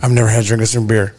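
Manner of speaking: mock confession. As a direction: admit it like a lightweight. Mocking the guy's inexperience.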